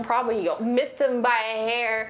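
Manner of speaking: ordinary delivery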